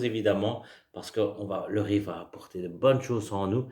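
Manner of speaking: ordinary talk, nothing marked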